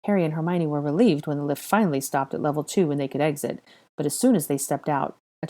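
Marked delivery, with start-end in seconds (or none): none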